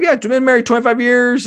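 high, cocky imitation of a boastful client; braggadocious. Coach imitating the client bragging about his marriage, high and cocky. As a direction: toss it off like you're showing off. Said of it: "kinda braggadociously".

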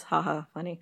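ordinary delivery

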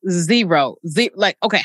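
ordinary delivery

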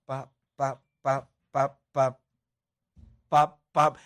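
short, spaced "pa" pops; low percussive pa sounds with gaps between; imitated ball hits. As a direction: mimic a ball being struck over and over.

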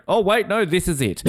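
high surprised, then dropping flat; playful letdown of a commentator; mock realization. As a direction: catch yourself, then deflate.

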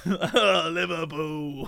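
slow, lightly mocked British; imitated British cheer. Streamer copying friend's British guy, slow mock accent. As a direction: stretch it out in fake British.

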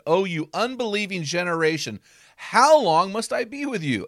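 Jesus exasperated with his disciples; exasperated exclaim. Shown as a demonstration. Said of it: "That's how I think he said it".

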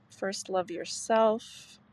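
normal talking voice

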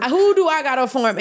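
high, loud mock demand; mock indignant question. Telling her producer she quits, high loud mock question. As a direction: ham up who to tell.

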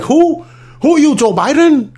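mock incredulity. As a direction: act astonished at who is calling. Acted Maduro not knowing the caller.

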